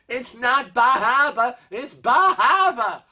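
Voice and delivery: "Bah Hahbah" in a lightly put-on New England accent. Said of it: "she was saying the same thing".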